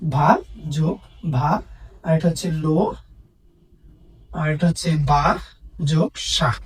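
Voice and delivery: "ভা", "লো", "বা", "সা" as separate, measured syllables. Shown as a demonstration. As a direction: spell out the beats "ভা", "লো", "বা", "সা", pausing after each. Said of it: "এক প্রয়াসে আমরা ভা পর্যন্ত উচ্চারণ করতে পারি".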